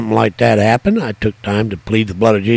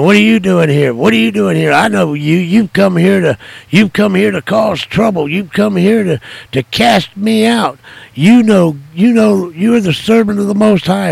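higher, louder accusing rant; angry ranting; 8 semitones higher, 4 dB louder than usual. Retold or imitated. Retelling a possessed man shouting at the preacher, high and loud. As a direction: hurl the accusations at him. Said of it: "started cursing and blaspheming, saying".

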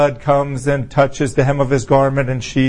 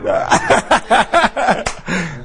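real higher-pitched laughter; hearty laugh; 5 semitones higher than usual. Real, not acted. Preacher after teasing someone's looks, laughing heartily. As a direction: laugh out at your own joke.

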